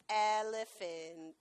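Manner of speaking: "Elephant." stretched out slowly and low; slow drawn-out command. Shown as a demonstration. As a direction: drag "Elephant." out to ask him to lie down. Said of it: "What would be more low?".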